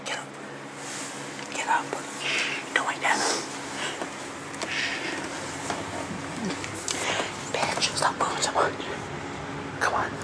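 whispering. Real, not acted. Sneaking, not waking dad.

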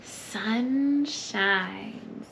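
ordinary delivery, nothing marked

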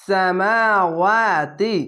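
slow "সামাওয়াতি" with মা and ওয়া stretched; drawn-out recitation of "সামাওয়াতি", long মা and ওয়া; one-alif elongation. Shown as a demonstration. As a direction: chant it, holding মা, then ওয়া. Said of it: "খার জবর এক আলিফ টেনে পড়বেন".

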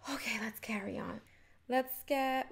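soft whisper, moving on; hushed self-prompt; whispered aside. Real, not acted. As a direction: whisper it to yourself.

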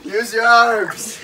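slow drawn-out laughing order; slow teasing. Real, not acted. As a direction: drag each word, teasing.

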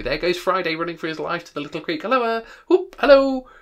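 high, loud whooping; excited calling out. A narrator's reading. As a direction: holler after someone in wild delight. Scrooge excitedly relives storybook scene.

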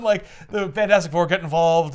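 ordinary talk

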